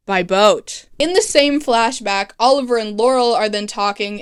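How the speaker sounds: ordinary talk, nothing marked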